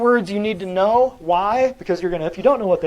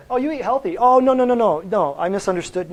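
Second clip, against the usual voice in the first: mock apology; 4 semitones higher, 3 dB louder than usual. Mocking the patient's excuse.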